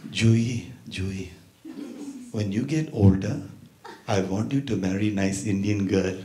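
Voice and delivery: put-on soft, low Indian-accented advice; quiet grandma voice, Indian accent; sweet Indian grandma. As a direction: counsel tenderly like an Indian grandmother. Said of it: "My grandma would say".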